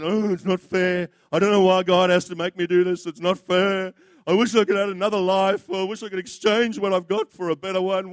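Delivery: a mock self-pitying whine, building; grumbling whine. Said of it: "That is called grumbling".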